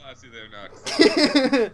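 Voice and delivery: slow, loud laughing tease; amused mock praise through laughter. Real, not acted. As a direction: laugh the words out at him. Reacting to a crude joke.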